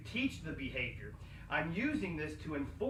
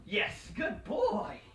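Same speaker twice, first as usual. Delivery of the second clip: slow, higher praise with a laugh; 5 semitones higher, much slower than usual. Real, not acted. Trainer praising the dog, slow, high and laughing. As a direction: reward him, chuckling.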